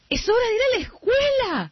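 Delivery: big sister tricking little sister about school; mock urgent. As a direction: trick her with fake urgency.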